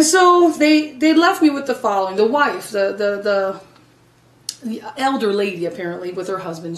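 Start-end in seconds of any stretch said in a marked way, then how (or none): none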